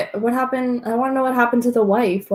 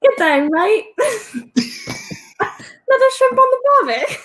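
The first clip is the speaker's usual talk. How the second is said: put-on Australian accent; 13 semitones higher than usual. Showing off to a co-host, laughing mock Australian accent. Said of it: "Let me hear your Australian accent".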